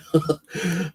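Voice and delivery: sudden high laugh; a short, real laugh breaking out; burst of laughter. Real, not acted. Remembering smashing the bully's head, he bursts out laughing.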